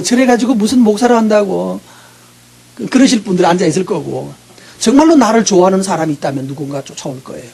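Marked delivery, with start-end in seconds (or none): none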